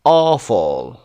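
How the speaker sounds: awful with AW hit hard; stress on first syllable. Shown as a demonstration. Teacher working through the exercise, first-syllable stress on awful. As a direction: punch the start of awful, let it fall. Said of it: "قسمت اول".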